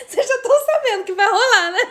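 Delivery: high voice full of giggles; laughing while talking. Real, not acted. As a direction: squeeze the words out between laughs. Laughing, audience guesses punchline.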